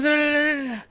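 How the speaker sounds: high imitation of a boy; panicking little boy. Boy running into the car, mock-panicked voice.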